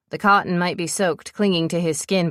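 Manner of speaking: ordinary delivery, nothing marked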